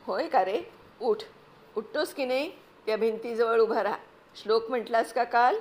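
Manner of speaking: loud, stern fatherly scolding; angry father. Acted. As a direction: bark the orders at the boy. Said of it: "रागाने म्हटलं".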